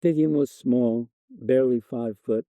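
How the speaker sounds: ordinary delivery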